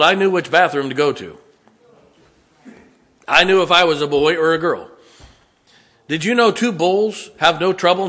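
loud, high-pitched sermon delivery; impassioned preaching. Real, not acted. Preacher warning church about the world, impassioned preaching. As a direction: preach it out with force.

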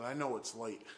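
soft and low; subdued apology. Retold or imitated. Brother apologising for the late call, quiet. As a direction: say it gently, keeping it small.

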